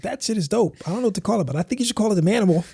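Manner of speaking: high mocking sister voice, fast at the end; teasing sister. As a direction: needle him playfully in a high voice. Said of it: "she's teasing me".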